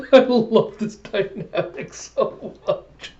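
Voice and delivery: high, slow, giggly delivery; laughing through words. Real, not acted. Fan delighted by the pair's dynamic, real laughing speech. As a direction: squeeze the words out between laughs. Still laughing while commenting.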